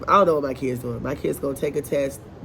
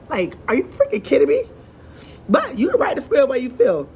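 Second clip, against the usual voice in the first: much higher and louder, incredulous; exasperated disbelief; 9 semitones higher, 4 dB louder than usual. Real, not acted. Reacting to the crying student, high loud exasperated disbelief. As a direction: throw your hands up in disbelief.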